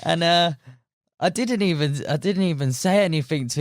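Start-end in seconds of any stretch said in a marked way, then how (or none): none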